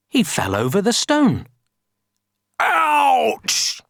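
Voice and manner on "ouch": high storybook yelp; yelling in pain. A narrator's reading. Giant falling over the stone, yelling. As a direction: yelp it, pitched way up. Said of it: "he yelled".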